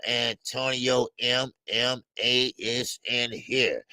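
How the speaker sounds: ordinary talk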